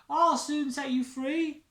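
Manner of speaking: high, loud reassuring call; crying out. Acted. Stickman calls up to the stuck Santa, loud and high. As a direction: shout up to reassure. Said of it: "cries Stickman".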